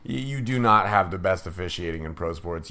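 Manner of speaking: normal talking voice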